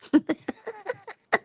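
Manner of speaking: high giggling laugh. Real, not acted. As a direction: giggle at the bird. Laughing at her bird.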